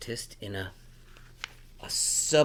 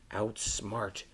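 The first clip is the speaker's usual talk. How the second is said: plain reading voice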